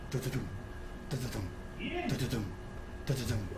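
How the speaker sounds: soft galloping hoofbeat sounds; imitated hoofbeats. Game master imitates hoofbeats chasing the player, softly. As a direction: tap out hushed hooves with the voice. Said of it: "the hoofbeats of something chasing".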